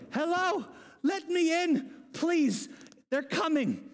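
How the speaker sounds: high, mock-desperate plea; pleading at the door. Peter still knocking, pursued by guards, pleading.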